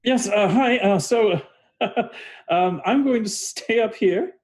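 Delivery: high-pitched, halting character voice with nervous laugh; queasy, composing himself. Acted. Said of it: "composes himself a bit 'cause he still feels really nauseous".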